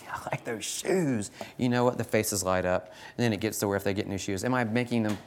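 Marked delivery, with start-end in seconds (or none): 0.0-1.3 slower, softer sweet compliment. Retold or imitated. Teacher complimenting a student's shoes, gentle and sweet. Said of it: "I will just tell students".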